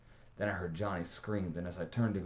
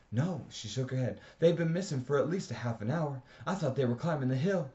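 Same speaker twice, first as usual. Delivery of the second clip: worried insisting; 8 semitones higher, 6 dB louder than usual. A narrator's reading.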